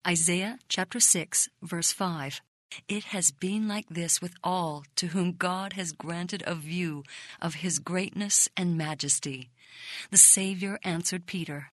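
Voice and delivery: ordinary delivery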